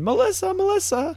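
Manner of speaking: high calling scream across a crowd; mock scream for Melissa; screaming a name. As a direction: yell the name out high, searching. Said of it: "Kel just starts screaming".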